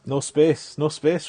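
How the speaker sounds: loud pleading call at the ball, rising high; urging shout. Real, not acted. Gamer urging his shot to stop short, loud shout. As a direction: yell at the rolling ball not to run on.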